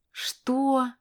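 высокое, протяжное презрительное «что»; переспроси свысока, не веря; презрение и недоверие. A narrator's reading. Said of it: "В её голосе было презрение". (презрительно и недоверчиво)

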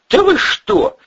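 very high, loud disbelief; shocked protest. Acted. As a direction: squeal in startled denial. Accused man protests in shock.

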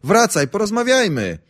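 put-on man shouting after his girlfriend from the window. A narrator's reading. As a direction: call her back from the window. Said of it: "Wychylam się przez okno i krzyczę".

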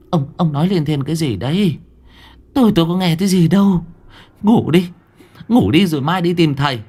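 high, stammering scolding; scolding wife. A narrator's reading. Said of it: "Mắng chồng như vậy thôi".

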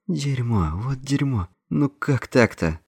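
high, anguished moaning; hoarse groan. A narrator's reading. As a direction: groan it out in dismay. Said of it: "Ники хрипло простонал".

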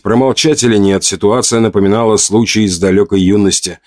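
ordinary delivery

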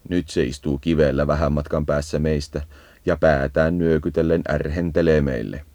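ordinary talk, nothing marked

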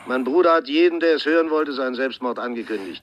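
indignant. Acted. Brother on the phone, outraged at the detective's claim. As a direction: cut him off, outraged.

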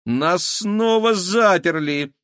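high, loud, slow outcry; indignant. A narrator's reading. Girl finds herself locked in the storeroom, indignant. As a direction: protest high and loud, drawing out the words. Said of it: "возмутилась Кира".

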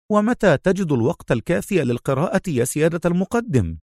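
high-pitched, impressed question; admiring Khaled. A narrator's reading. Khaled asks his superior, admiring and high. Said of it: "وهو يراقبه في إعجاب".

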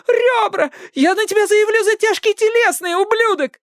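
very high, tearful screech; pained outrage. A narrator's reading. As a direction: wail threats through the pain. Hurt girl screaming at captor.

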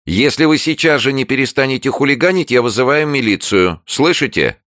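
high, loud, threatening neighbour voice; frightened, menacing neighbour. A narrator's reading. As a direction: threaten shrilly through a locked door. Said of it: "И неровный от страха, но тем не менее очень грозный голос предупредил". (испуганно-грозный голос соседки)

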